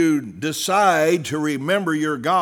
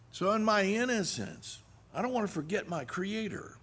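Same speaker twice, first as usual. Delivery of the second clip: much softer, still high; sudden quiet; 5 semitones higher, 10 dB quieter than usual. Acted. Preacher turning inward, quiet. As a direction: drop to a hush. Sudden drop after the climax.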